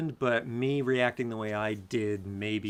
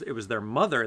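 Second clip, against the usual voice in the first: ordinary talk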